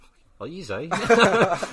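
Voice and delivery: a stunned, laughing shout of disbelief; incredulous laughing yell. Retold or imitated. Reacting in disbelief to the copied play.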